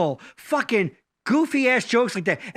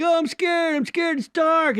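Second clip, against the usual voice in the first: put-on higher, lightly whiny scared kid; 6 semitones higher than usual. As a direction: play the frightened little boy, higher. Kid voiced in a joke.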